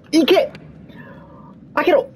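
high urgent shouts; shouting. Real, not acted. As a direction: yell it, will it open. Urging the door open. (叫んで応援する声)

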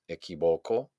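low "equivoco", weight on VO; stress on VO. Shown as a demonstration. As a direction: lean on VO in "equivoco". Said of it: "hago un golpe de voz". ("equivoco" acentuado en VO)

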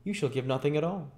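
high, lightly amused lady's voice; smiling young lady. Acted. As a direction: answer the boy with a smile. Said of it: "said Miss Maylie, smiling".

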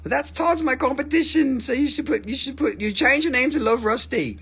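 high-pitched kidding complaint; joking high-voiced objection; mock jealous protest. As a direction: whine in pretend jealousy, high. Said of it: "No, I'm just kidding".

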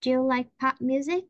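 "music" lifted up at the end; rising question tune. Shown as a demonstration. Teacher modeling the worksheet item, rising tune. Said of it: "Cô làm mẫu nè".

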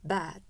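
Bad with a wide, long ae vowel; Bad said with a big open vowel. Shown as a demonstration. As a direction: stretch the lips wide on Bad. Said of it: "다시 한 번만".

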